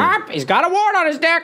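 parrot squawk. Pretend. Caged parrot on the porch squawking crude lines, high parrot squawk.

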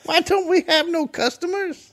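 put-on high childish question; kid voice. Playing the child.

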